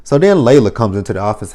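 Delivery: ordinary talk, nothing marked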